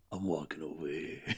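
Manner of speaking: a softly voiced tourist's New York accent. Telling cohosts about his New York trips, mock tourist New York accent. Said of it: "that guy who comes to New York and goes".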